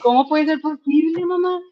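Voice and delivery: lightly voiced child, high and incredulous; imitating puzzled son. Son asking mother how it's possible, imitated puzzled child. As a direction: raise the pitch for a child's puzzled question. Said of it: "O sea, me decía como".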